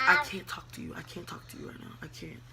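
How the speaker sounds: soft retold brush-off fading to whisper; imitated hushed refusal. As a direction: drop into a near whisper. Retelling hushed refusal to partner.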